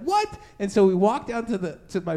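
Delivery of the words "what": very high startled "What?"; dad's surprise. Retold or imitated. Dad hearing someone climbed in the window, a startled high cry. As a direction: jump in pitch, caught off guard. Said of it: "And he's like".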